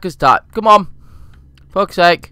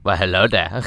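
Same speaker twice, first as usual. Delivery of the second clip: lower, suave greeting to himself; a hint of a smooth flirt; flirty hello; 6 semitones lower, 4 dB quieter than usual. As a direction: charm your own reflection. Greeting himself through portal.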